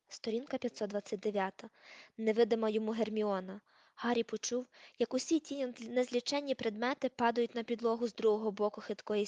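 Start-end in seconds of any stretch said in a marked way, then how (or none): none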